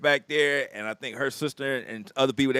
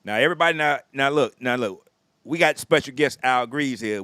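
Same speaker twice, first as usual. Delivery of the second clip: ordinary talk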